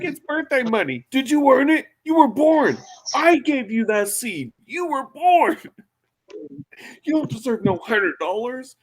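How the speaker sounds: very high, shrill mock scolding; over-the-top father ranting at his son; hammed-up outraged dad. Acting John scolding son.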